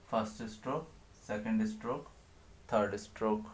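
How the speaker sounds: soft, low counting of each stroke; quiet stroke count. Shown as a demonstration. Teacher counts strokes softly while writing. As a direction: murmur the count while drawing.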